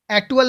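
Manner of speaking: "एक्चुअल" with a hard T replacing CH; wrong pronunciation. Shown as a demonstration. Teacher telling students what not to say, ek-tu-al mispronunciation. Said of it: "नहीं बोलेंगे इसको".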